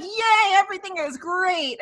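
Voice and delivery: high, chirpy mock cheer; sing-song fake delight; oblivious cheer. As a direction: imitate a clueless, happy doctor. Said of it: "He's like".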